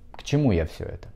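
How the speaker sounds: usual speaking voice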